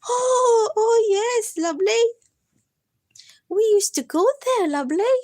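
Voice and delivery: high, loud cooing of a pleased mom; mom's delighted reply. Acted. Friend imagining mom's answer to Susan, high and delighted. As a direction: act out mom exclaiming with delight. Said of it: "And she said".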